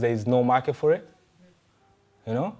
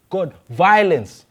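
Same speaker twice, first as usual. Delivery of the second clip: loud, high, drawn-out plea; crying to God; 7 semitones higher, 7 dB louder, much slower than usual. Acted. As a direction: cry out slowly and forcefully in anguished appeal. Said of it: "He's crying to God".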